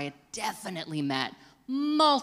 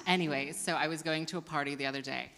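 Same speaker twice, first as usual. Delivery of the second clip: rushed retake; 6 dB quieter, faster than usual. Shown as a demonstration. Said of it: "I'll take it again".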